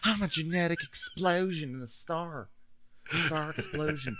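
mock ditzy star voice. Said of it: "mocking Grace Lily".

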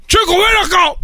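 high sharp call; mock angry dad; father calling. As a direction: summon the boy angrily.

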